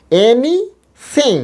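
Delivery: এনিথিং stretched into two slow parts, high; slow syllable demo. Shown as a demonstration. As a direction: draw out এনিথিং syllable by syllable, "এনি", then "থিং". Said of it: "আবার বলছি". (এনিথিং টেনে, ভেঙে ধীরে উচ্চারণ)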